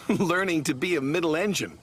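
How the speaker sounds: laughing. A narrator's reading. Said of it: "Percy laughed".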